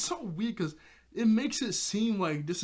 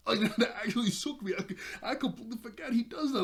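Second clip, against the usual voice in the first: real laughter, higher and quick; laughing; 7 semitones higher, faster than usual. Real, not acted. Host shocked at Jeremy prying it open, laughing. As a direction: laugh through it, still shaken.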